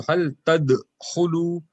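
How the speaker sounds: slow "hal tadkhulu" with a bounced d before khu; qalqalah on dal. Shown as a demonstration. Teacher shows sukoon rule to students, "tadkhulu" with bounced d. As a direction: release the d in "tadkhulu" with a small echo, slowly. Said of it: "Dal will have a kalkala now".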